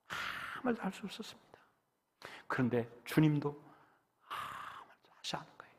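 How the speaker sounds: soft unvoiced whisper; hushed whisper. Pastor hushing the room at the silent meeting. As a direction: breathe the words, barely voiced.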